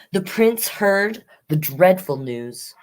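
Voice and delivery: ordinary delivery, nothing marked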